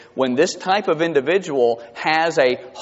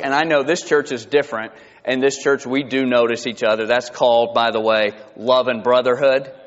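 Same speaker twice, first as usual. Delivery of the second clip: ordinary talk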